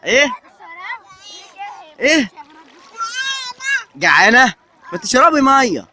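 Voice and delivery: playful parent teasing toddlers in the water. Real, not acted. Parent teases small daughter. (صوت عالٍ مرح للأطفال الصغار)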